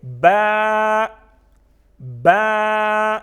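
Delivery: با held long and loud on a level note; drawn-out letter. Shown as a demonstration. Teacher drilling the next letter, با loudly stretched. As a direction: chant با with a stretched vowel. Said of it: "اس کو ہم کہیں گے".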